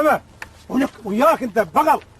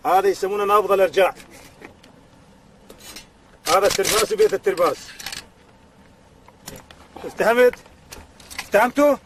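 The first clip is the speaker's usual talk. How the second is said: low, deliberate instructing voice; instructor lecture; 6 semitones lower than usual. Acted. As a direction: teach it step by step. Weapons lesson lecture.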